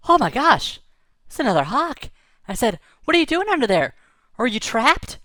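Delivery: can't believe there's a hawk under there; amazed shout. Retold or imitated. Boy finding a trapped hawk, calls out astonished.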